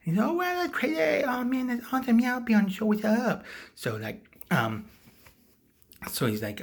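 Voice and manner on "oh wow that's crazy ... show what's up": very high, loud gushing; hammed-up thrilled voice; mock delight. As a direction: accept like an overjoyed fan. Acts out delighted invited guest.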